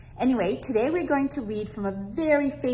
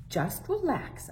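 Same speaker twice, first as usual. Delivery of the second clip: soothing grandma; 5 semitones higher, 5 dB quieter than usual. Acted. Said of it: "Abuela said".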